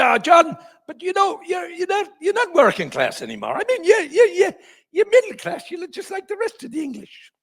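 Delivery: very high-pitched, stammering impression; imitated squeaky interviewer pressing his guest; mock cajoling host. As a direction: badger him in a high, exaggerated voice.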